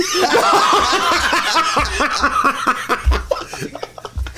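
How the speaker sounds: long wheezing high laugh; helpless laughter. Real, not acted. Cracking up at own story.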